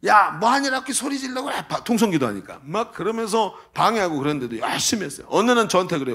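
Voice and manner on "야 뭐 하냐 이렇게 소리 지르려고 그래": high-pitched imitation of yelling parents; imitated scolding from outside the room; mock parental yell. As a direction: holler like a cross parent, without real volume. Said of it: "엄마 아빠가 소리 꽥꽥 지르죠".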